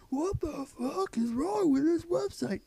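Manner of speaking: high, loud, slow mocking cry; mock shrieking commenter. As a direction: screech it like a pterodactyl. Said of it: "unending cries of".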